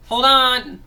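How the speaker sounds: real loud shout to the dog; calling out. Real, not acted. Boy shouts at his dog biting the gate, loud real call. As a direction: yell across the room to stop it.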